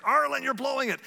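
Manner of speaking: high, loud interjection; mock alarmed call-out. Eric interrupts the preacher over the missed verse, mock alarmed. Voices Eric calling him out.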